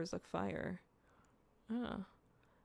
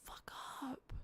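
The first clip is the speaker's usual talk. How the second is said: whispered retort; 6 semitones higher, 13 dB quieter than usual. Retold or imitated. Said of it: "I was like".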